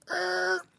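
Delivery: high imitated record scratch; mock record-scratch noise. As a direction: screech like a needle dragged off vinyl. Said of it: "vinyl record scratch in the party".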